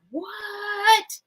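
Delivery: high, incredulous What; mock astonished coworkers; amazed question. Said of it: "They're like".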